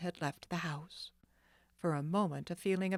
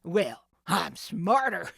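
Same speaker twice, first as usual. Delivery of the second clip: slow loud smug gloat; 6 dB louder than usual. Acted. The thief gloats over Nancy, slow smug. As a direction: savour the win.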